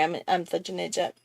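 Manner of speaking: ordinary talk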